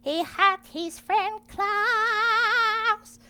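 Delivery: very high, loud and slow squeal; drawn-out loud squeal; squealed narration. Acted. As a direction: squeal it out with delight.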